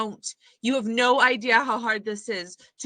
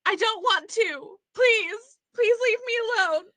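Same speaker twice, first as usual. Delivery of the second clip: frightened pleading; 11 semitones higher than usual. Acted. Frightened patient begging to be left.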